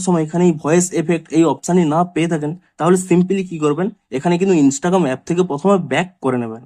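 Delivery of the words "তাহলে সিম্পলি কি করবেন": ordinary delivery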